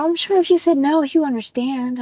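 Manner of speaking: retold reassurance. Voicing the son's reassurance.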